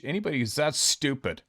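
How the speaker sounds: ordinary talk, nothing marked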